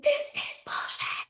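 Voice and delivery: imitating scream sobbing. Calling friend Sarah, mock sobbing wail. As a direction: replay the sob on the phone, half-voiced. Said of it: "I was scream sobbing".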